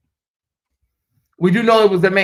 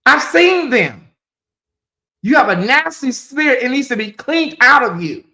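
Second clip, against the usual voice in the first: preacher rebuke; 6 semitones higher than usual. Real, not acted. Rebuking the anonymous caller, fiery preacher voice. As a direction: cast the demons out like a preacher.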